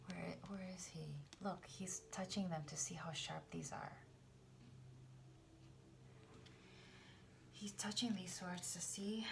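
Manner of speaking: hushed unvoiced whisper. Real, not acted. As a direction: lean in and whisper. Whispered look at the card.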